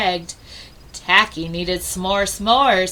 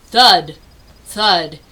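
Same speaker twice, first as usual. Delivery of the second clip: ordinary delivery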